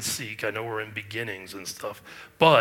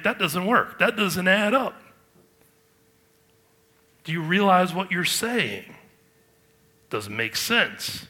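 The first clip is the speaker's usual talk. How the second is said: high, loud mock incredulity; sarcastic Jesus; 7 semitones higher, 5 dB louder than usual. Preacher voicing Jesus answering his accusers, sarcastic. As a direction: challenge them with pointed sarcasm, pitched up. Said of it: "Jesus has a little bit of sarcasm here".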